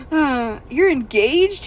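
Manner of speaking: slow, loud, lightly voiced surprise; wounded ogre, lightly voiced; surprised ogre. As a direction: stretch it out like a hurt ogre.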